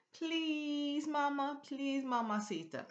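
high, drawn-out wheedle; sing-song mock begging; playful pleading. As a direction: beg like a child working on mama. Acts out child begging mama.

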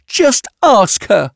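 high, drawn-out insistent demand; exasperated insistence. A narrator's reading. Scientist repeats her demand to her colleague, exasperated. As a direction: push harder, high and slow. Yatena insists, arguing with Brax.

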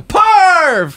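high, loud drawn-out call; summoning shout. Pretend. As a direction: wail the name to summon him. Teasing summoning call again.

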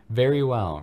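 knowing. A narrator's reading. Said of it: "replied Dumbledore knowledgeably".

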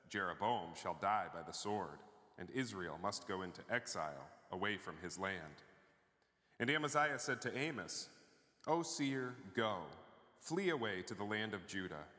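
ordinary talk

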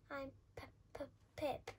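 small, hesitant stutter on the name; timid, halting introduction; nervous stammer. Acted. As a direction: stammer the P before getting the name out. Scared mouse introduces himself in cave.

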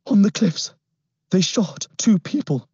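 put-on high, breathless boy's voice; frightened boy. A narrator's reading. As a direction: blurt it out scared. Frightened boy reporting murder.

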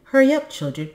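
high, loud hurrying call; urgent calling. A narrator's reading. Mother rushes her children out the door, urgent calling. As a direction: chivvy them along urgently.

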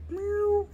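high mock meow; squeaky playful kitty meow; cat meow. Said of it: "Kitty cat".

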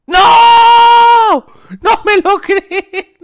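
shouts high and loud, then laughs in disbelief; a howl of disbelief breaking into laughter; real frustrated shout. Real, not acted. Player falls at the very end, shouting and laughing.

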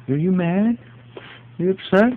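high sing-song teasing questions; high teasing dog-talk. Real, not acted. Owner teases the angry dog in high voice.

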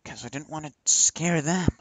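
ordinary talk

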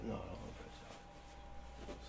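hushed, slow whisper; scared whisper. Retold or imitated. Son too scared to press charges in front of dad, whispering. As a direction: barely dare to say it.

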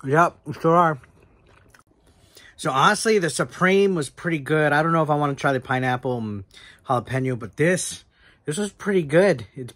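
slightly full mouth; words around food; talking while chewing. Real, not acted. Seen 2: bit pizza at -2s, speaks holding slice, likely still chewing.

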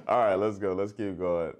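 ordinary talk

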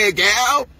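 a rival voiced in a high, jeering tone; mocking taunt. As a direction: throw the insult up high and mocking. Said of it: "I say".

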